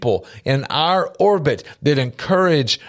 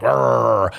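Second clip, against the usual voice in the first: short mock growl; mock dog growl at a morning greeting; 4 semitones lower than usual. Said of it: "You're like".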